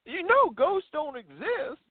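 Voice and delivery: high, slow Tennessee local; Tennessee accent. Retold or imitated. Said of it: "I'm trying to do my best Tennessee accent".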